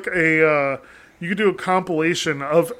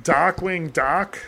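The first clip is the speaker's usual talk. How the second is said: tentative Boston take, rising at the end; slow, unsure Boston attempt; Boston accent; 3 dB quieter, much slower than usual. Shown as a demonstration. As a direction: try "Darkwing" without the R, questioning. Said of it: "with a Boston accent".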